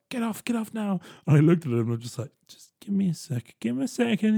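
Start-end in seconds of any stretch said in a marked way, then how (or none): 0.1-1.0 impatient bus driver. Retold or imitated. Bus driver about to order the old man off, impatient. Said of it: "he was about to be like". 2.5-4.3 high, pressed plea for patience; urgent pleading. Retold or imitated. Go-between stalling the bus driver, pleading.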